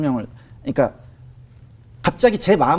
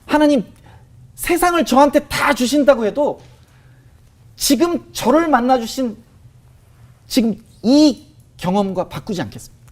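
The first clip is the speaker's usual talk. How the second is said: slow, higher, earnest vow to God; heartfelt confession; 7 semitones higher, 3 dB louder, slower than usual. Retold or imitated. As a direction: lay out each word slowly as a sacred promise. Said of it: "저도 모르게 고백을 하게 됐어요". (높고 느리게 간절히 드리는 고백)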